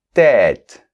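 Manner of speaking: Tête with a wide open è sound. Shown as a demonstration. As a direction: say Tête loud, stretching the open vowel. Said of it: "ça ouvre".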